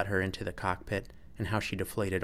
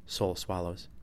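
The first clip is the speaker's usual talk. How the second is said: ordinary delivery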